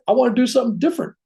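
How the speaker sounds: high, fast and eager; excited surgeon. Retold or imitated. Surgeon explains his new plan to the wife, eager. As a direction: sell the bold new idea.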